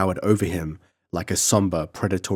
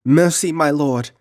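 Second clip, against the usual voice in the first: high, loud pleading cry; child begging the count for mercy; sobbing plea; 7 semitones higher, 5 dB louder than usual. Acted. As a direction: beg for mercy through tears. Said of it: "sobbed Tina".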